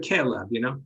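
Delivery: ordinary talk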